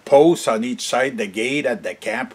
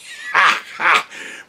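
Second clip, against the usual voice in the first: airy burst of laughter; 5 semitones higher than usual. Real, not acted. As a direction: let a hearty breathless laugh out. Laughing about throwing things away.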